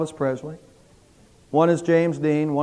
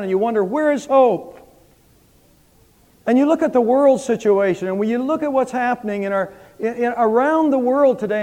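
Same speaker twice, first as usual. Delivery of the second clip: higher, impassioned pastoral appeal; preaching; 5 semitones higher than usual. Acted. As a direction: reach out to the hurting listeners.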